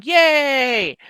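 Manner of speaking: a high, loud, happy yay; excited cheer. Real, not acted. Reacting to the finalized handfasting with a happy cheer. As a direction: cheer out at the good news. Reading good news from chat.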